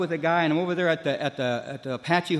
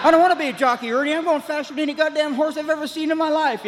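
frantic high; 11 semitones higher, faster than usual. Retold or imitated. Telling Ernie he won't be a jockey, wired and frantic. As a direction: sound like you're speeding out of your skull.

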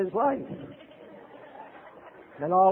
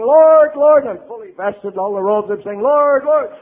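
much louder, higher pleading call; imploring cry; 4 semitones higher, 10 dB louder than usual. Retold or imitated. Priest in Montreal begging the Lord, loud imploring. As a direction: cry out to heaven. Priest calling on God in crisis.

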